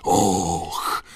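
drawn-out "och" of wonder; stifled gasp of awe. A narrator's reading. Crowd watching the fireworks-like show, stifled cry. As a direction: let out a muffled cry of amazement. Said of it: "wyrwały się zduszone okrzyki".

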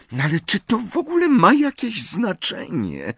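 high, exasperated sigh of a question. A narrator's reading. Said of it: "westchnęła Hermiona". (pytanie z westchnieniem)

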